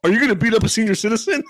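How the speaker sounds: neighbor's plea. Retold or imitated. Senior citizen facing the builder, mock alarm. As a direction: imitate an alarmed older man challenging you.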